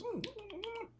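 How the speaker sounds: squeaky high munching noise; mouse munching hum. Retold or imitated. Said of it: "Little sound".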